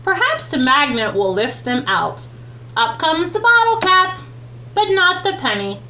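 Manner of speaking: ordinary delivery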